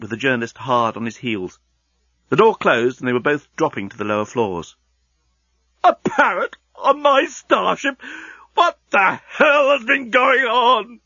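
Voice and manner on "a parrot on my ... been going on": slow, very high-pitched rant; drawn-out, hysterical indignation; apoplectic outrage. Acted. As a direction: wail each word out in disbelief and fury. Enraged genius shouting in the lift.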